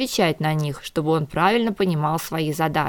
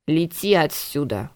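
usual speaking voice

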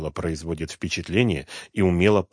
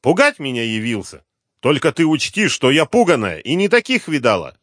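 high, loud angry tirade; angry scolding; 9 semitones higher, 7 dB louder than usual. Acted. Cornered woman rails at the detective, angry. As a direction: tear into him, defiant.

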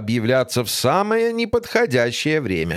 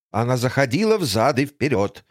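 usual speaking voice

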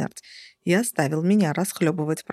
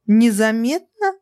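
louder, higher, slower near-shout; almost shouting; 4 semitones higher, 7 dB louder, slower than usual. Acted. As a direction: nearly scream the word. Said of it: "почти сорвалась на крик я".

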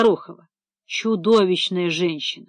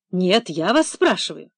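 ordinary delivery

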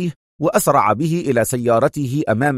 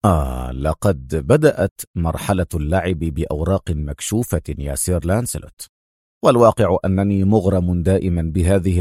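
cool, low-pitched composure; cold; 7 semitones lower than usual. A narrator's reading. Adham answering his angry rival, cold. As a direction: answer coldly in a much lower voice. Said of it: "أجابه أدهم في برود".

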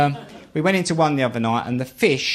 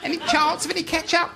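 सुर काफ़ी ऊँचा करके, मीठी विनती; वेट्रेस से बनावटी नम्रता से केचप माँगना; mock polite request; 12 semitones higher than usual.

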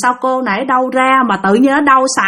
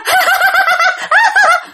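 very high-pitched imitated laughter; eerie acted laugh. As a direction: let out a long, high staccato laugh. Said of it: "chồng bạn cười luôn". (tràng cười nhại cao vút)

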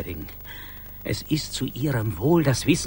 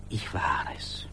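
whispered admission; 12 semitones lower, 8 dB quieter than usual. Acted. Hushed confession to colleague.

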